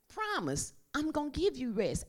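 Jesus reassuring the listener; soft, high promise. Acted. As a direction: whisper the promise close. Voicing Jesus's promise tenderly.

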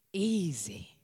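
a soft breathy aside, unvoiced; whispered. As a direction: whisper the word easy softly. Whispered word in sermon.

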